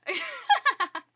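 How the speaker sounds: loud, high witch cackle; evil cackle. Acted. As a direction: cackle like a villain. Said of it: "I just cackled like a straight up evil".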